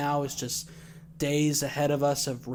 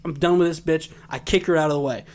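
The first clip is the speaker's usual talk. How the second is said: ordinary talk, nothing marked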